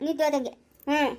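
ordinary talk

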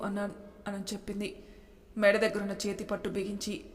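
plain reading voice

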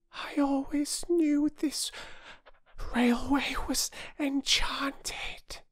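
high, slow, whispered wonder; breathless. A narrator's reading. Little girl sure the railway is magic, breathless whisper. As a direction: hush it like a secret. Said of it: "said Phyllis breathlessly".

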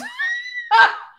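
very high laughing shriek. Real, not acted. Laughing after the shout.

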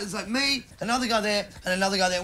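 ordinary talk, nothing marked

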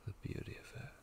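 breathy whisper of awe; soft tender whisper. Acted. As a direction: whisper it like a secret. Tender vow to his wife.

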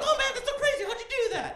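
very high, loud amazed squeal; hammed-up amazement, laughing; mock amazed coworkers. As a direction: gasp like stunned fans. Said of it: "And they're like".

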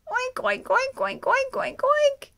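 very high, loud chorus of oinks; all the pigs oinking. Acted. All pigs wanting the apple, very loud high oinks. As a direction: squeal together. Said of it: "say all the pigs".

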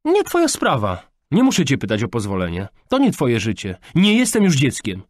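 loud, high-pitched rebuttals; defiant teenage outburst; angry defiance. A narrator's reading. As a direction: assert your independence furiously. Daughter defies father in quarrel.

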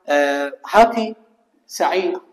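usual speaking voice

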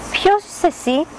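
high, curious swan voice; a lifted, wondering question from a graceful bird; the swan asking. A narrator's reading. A swan asking the stranger duckling who he is, high and curious. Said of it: "τον ρώτησε ένα από αυτά".

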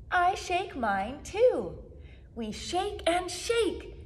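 usual speaking voice

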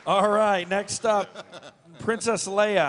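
normal talking voice

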